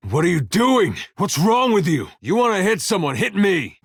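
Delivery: high, indignant outburst; angry confrontation. Acted. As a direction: challenge someone who just hit a friend. Angry at player hitting others.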